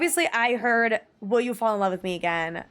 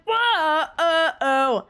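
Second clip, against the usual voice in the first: mock sob; 6 semitones higher, 4 dB louder, much slower than usual. Fake crying over the song.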